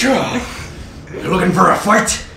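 drawn-out threatening question; menacing slow challenge. Acted. Bar tough daring the player, slow and menacing. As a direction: lean in and dare him slowly. GM voices human picking fight.

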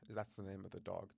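ordinary delivery

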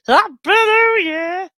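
very loud, high, stretched-out whoop; New Year cheer. Real, not acted. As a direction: shout the greeting with long vowels.

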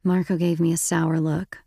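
plain reading voice

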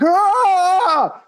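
high piercing imitated scream. Dad screaming at the elevator from a burn, imitated high scream. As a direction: shriek out like sudden pain. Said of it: "my dad just starts screaming".

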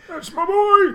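very high, squeaky proud cheer; hammed-up proud squeal; mock catchphrase. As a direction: squeal it like a cartoon dad. Put-on voice quoting catchphrase.

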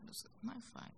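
breathy whisper of alarm; a frightened whisper to herself; whispered thought. Retold or imitated. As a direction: hush it like a scared inner voice.

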